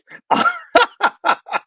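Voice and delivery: high, loud burst of laughter; big laugh. Real, not acted. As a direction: let a real laugh burst out. Laughs at her friend on camera.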